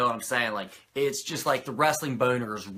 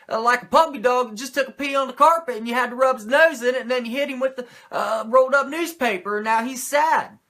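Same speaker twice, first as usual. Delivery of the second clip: high, loud rambling wrestler promo; Mongo impression; 13 semitones higher, 9 dB louder than usual. Impersonating Mongo to Norton, loud and high. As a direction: bellow nonsense like a hyped wrestler. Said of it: "That's how Mongo talks".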